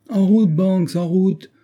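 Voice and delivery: high, loud impatient call; excited rallying cry; eager shout. A narrator's reading. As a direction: leap up ready to go. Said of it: "s'écria le capitaine Hod".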